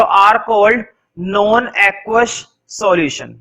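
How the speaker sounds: slow, word-by-word dictation; teacher dictating to the class. Shown as a demonstration. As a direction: spell it out for note-taking. Teacher dictating notes slowly.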